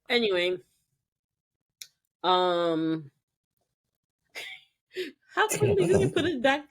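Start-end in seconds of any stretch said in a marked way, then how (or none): 4.3-6.6 laughing high question. Real, not acted.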